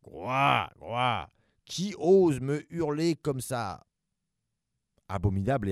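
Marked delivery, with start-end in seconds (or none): 0.0-3.9 slow growling monster voice; growling giant monster. Acted. Giant monster scolding the two kids, slow growl. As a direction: rumble it like a huge angry beast. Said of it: "Il se mit à gronder".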